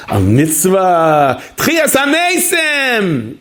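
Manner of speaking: high, very slow, drawn-out lure; tempting voice. Retold or imitated. Tempter offering the pastry as a mitzvah, high slow seductive lure. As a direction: dangle each word like bait.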